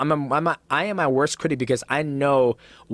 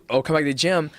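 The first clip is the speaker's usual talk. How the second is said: ordinary delivery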